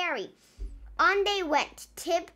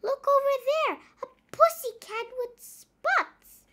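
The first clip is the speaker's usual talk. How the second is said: high, excited little pirate; high character voice; 11 semitones higher, 4 dB louder than usual. Acted. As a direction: point it out eagerly, high up. Character voice Nong.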